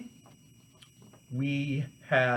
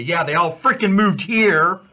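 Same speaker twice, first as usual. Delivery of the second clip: louder exasperated outburst; fired up; 3 semitones higher, 9 dB louder than usual. Real, not acted. Host griping to viewers about Californians moving in, fired up. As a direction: vent at everyone who moved in. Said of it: "Sorry, I get a little fired up".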